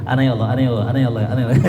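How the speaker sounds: "Ana ya Allah" gabbled fast, words running together; rushed dhikr. Shown as a demonstration. Preacher mocking hurried chanting, said too fast. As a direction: rattle off "ana ya Allah" carelessly, too quickly. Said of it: "jangan jadi kayak wiridan cepet... Kagak gitu".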